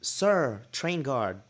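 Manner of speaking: high, loud pleading call; overdone dramatic appeal for attention; put-on drama. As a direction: mock-desperate hail to a guard. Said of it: "I'm trying to make it more dramatic".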